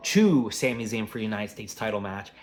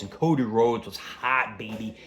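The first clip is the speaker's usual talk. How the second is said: normal talking voice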